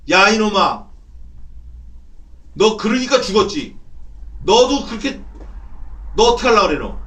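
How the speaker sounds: loud, high-pitched berating; slandering enemy. Acted. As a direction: shout accusations at someone to shame them. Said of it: "비방하는 거야, 이렇게".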